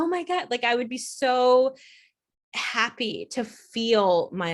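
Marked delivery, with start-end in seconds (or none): 0.0-0.5 much higher delighted gasp; dramatic joy. Retold or imitated. Feeling her emotions again, dramatic overjoyed gasp. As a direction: gush with delight. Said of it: "like, I would go".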